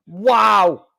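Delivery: usual speaking voice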